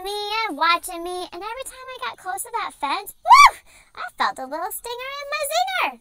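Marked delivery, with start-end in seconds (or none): 3.2-3.6 startled whoop. Acted. Zapped by electric fence.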